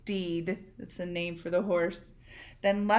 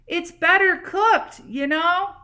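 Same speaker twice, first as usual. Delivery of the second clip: higher, louder call down; yelled; 9 semitones higher, 9 dB louder than usual. Acted. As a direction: yell it down cheerfully. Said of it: "She yelled".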